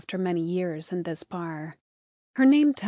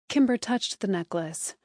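usual speaking voice

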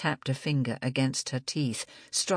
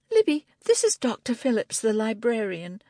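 higher friendly introduction; 7 semitones higher than usual. Acted. Angela introducing.

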